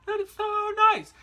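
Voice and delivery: high, loud, gushing delight; gushing relief. Retold or imitated. Retold delight at delayed flights.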